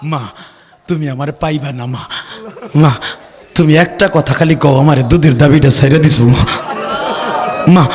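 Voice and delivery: low, halting plea to mother; son's farewell plea. Acted. Doomed son phones his mother.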